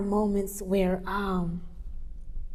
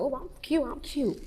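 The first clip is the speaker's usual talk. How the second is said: higher, soft self-admiring quote; pitched up, quietly chuffed; pleased with herself; 6 semitones higher, 10 dB quieter than usual. Retold or imitated. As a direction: preen softly, lightly mimicking yourself. Voices self admiring her outfit.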